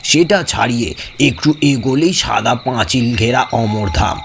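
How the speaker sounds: usual speaking voice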